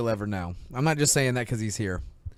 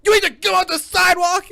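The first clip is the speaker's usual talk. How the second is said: high, loud dad yell, imitating; mock outraged shout; 10 dB louder than usual. Playing Will grilling his son, mock outraged shout. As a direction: shout it like an appalled father.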